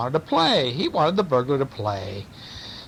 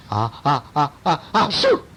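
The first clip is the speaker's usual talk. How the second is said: acted sneeze. A narrator's reading. Unseen burglar sneezes in the house, acted sneeze. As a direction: hold back a sneeze, then let it explode.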